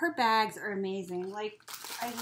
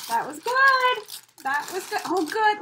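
delighted; 9 semitones higher, 8 dB louder than usual. Real, not acted. Repeating in delight.

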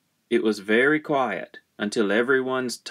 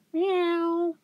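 very high, thin meow; imitated high meow; cat's meow. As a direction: mew like a small cat. Cat agrees.

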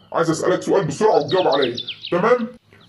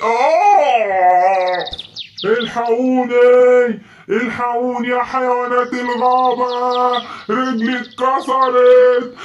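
calling for help; 4 semitones higher, slower than usual. Acted. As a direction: cry out for rescue after a fall. Elephant slips and breaks leg.

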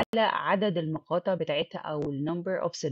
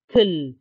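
kill said alone, louder; isolated syllable; 8 dB louder than usual. Shown as a demonstration. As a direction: punch kill out as the final chunk. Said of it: "ال consonant plus L E". (kill كمقطع أخير بصوت أعلى)

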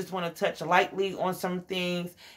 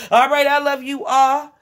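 much higher and louder burst of love; excited sign-off; 8 semitones higher, 9 dB louder than usual. Real, not acted. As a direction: shout your love to the viewers.

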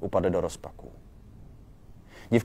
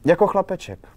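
high, loud blurt; raised voice on an unguarded comparison; 11 semitones higher, 8 dB louder than usual. A narrator's reading. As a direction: blurt the word out with a jump in pitch. Girl blurts out an unflattering comparison.

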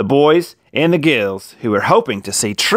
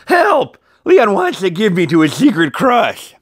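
cry for help; 8 semitones higher than usual. A narrator's reading. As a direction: yell for rescue like a cartoon. Valentine cries for help.

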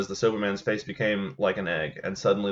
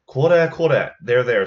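calming call; 2 semitones higher, 9 dB louder, slower than usual. Acted. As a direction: call out to calm him down. Seller calming a panicked man.

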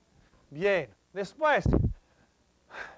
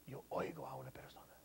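whispered, fast and secretive; 21 dB quieter, faster than usual. Shown as a demonstration. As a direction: hush it like hearing someone nearby. Teacher acts out hearing someone.

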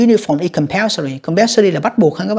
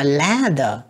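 "ladder" with LAD raised, der dropping; first-syllable stress. Shown as a demonstration. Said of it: "âm một đó nha". ("ladder" nhấn âm một)

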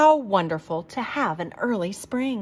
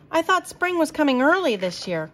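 higher, whiny complaint; complaining; 7 semitones higher than usual. A narrator's reading. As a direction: grumble about the cold. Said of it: "complained Squirrel".

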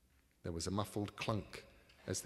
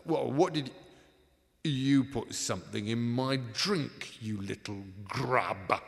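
angry accusation; 3 semitones higher, 9 dB louder than usual. Acted. Poisoned man accusing partner.

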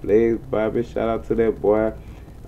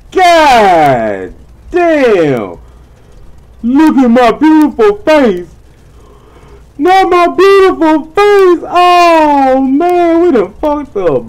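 very high, loud anguished wail; dismayed wail; 10 dB louder, much slower than usual. Real, not acted. Seeing the smashed front end of the car, anguished high wail. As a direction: wail over the ruined car.

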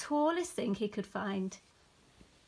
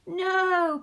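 loud, high bleated refusal; bleating sheep; 8 semitones higher, 8 dB louder than usual. A narrator's reading. Sheep refusing Douglas a hug, bleating. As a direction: baa it out like a cross sheep. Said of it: "barred the sheep".

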